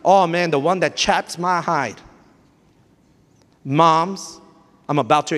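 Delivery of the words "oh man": ordinary talk, nothing marked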